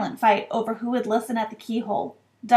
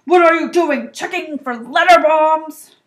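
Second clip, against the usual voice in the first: loud, high jeering call; booming, drawn-out shout; shouted joke; 6 semitones higher, 10 dB louder than usual. A narrator's reading. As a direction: call it out, pleased with your joke. Uncle still shouting from kitchen.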